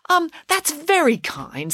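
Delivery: hesitant polite brush-off; polite hesitation. A narrator's reading. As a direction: decline without offending. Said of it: "said Morgiana".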